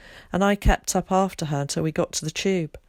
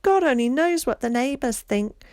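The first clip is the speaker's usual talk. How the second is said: higher, louder mocking mimicry of mum; 7 semitones higher, 4 dB louder than usual. A narrator's reading. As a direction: parrot mum's words in a sing-song. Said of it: "Her voice went up an octave and she crooned".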